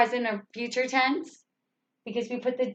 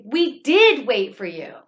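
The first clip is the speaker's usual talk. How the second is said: louder, falling "did" with energy; excited exclamation; 6 dB louder than usual. Shown as a demonstration. Teacher showing the excited version of the sentence. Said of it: "you can be excited about it".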